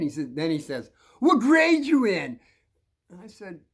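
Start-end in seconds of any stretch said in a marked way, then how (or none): 1.2-2.4 loud, slow, high challenge; bully's demand. Retold or imitated. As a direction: imitate a bully sizing up a smaller kid. Bully demands the kid's grade.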